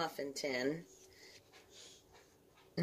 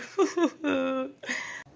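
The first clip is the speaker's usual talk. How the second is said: long, high-pitched giggle; 11 semitones higher than usual. Real, not acted. Cook admitting to having no jalapeno, high giggle. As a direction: giggle at your own shortcoming. Laughs about the missing jalapeno.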